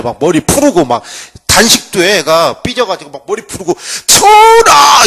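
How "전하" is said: high, loud call of protesting scholars; protesting students cry out to the sleeping king; shouting to the king. Retold or imitated. Students calling at palace.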